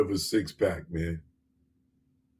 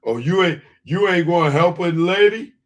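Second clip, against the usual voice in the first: mock woman; 6 semitones higher, 5 dB louder than usual. Mocking woman asking for help.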